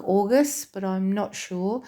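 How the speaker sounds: ordinary talk, nothing marked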